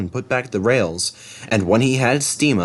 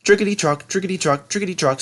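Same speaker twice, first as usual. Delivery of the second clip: rhythmic chant; 9 semitones higher, faster than usual. Acted. Coaches' rhythm.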